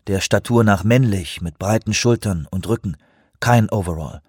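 usual speaking voice

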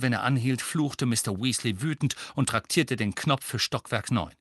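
ordinary delivery

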